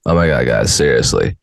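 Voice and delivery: stupid voice. Acted. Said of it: "read it in a stupid ass voice".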